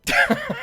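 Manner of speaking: shrieking, incredulous laugh; sudden loud cackle of disbelief; high burst of laughter. Real, not acted. Reacts to YouTube second chance.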